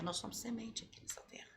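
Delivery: soft whisper of a key truth; whispered line. Real, not acted. Pastora whispering to viewers about purpose. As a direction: breathe it out like a secret. Preacher drops to intimate point.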